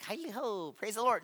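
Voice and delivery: a hint of a cheery churchy neighbor, hushed; soft mock Ned Flanders cheer. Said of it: "You're like Ned Flanders".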